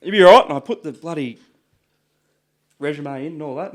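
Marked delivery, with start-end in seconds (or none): 0.0-0.5 high, loud, breezy brush-off; confident retort. Retold or imitated. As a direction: wave off the doubter with cocky confidence. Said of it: "I said".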